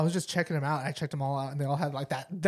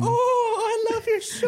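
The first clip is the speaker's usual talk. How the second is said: much higher, squealing delight; high gushing fan voice; 4 dB louder, slower than usual. Acted. Starstruck fan gushing over the show in a high squeal.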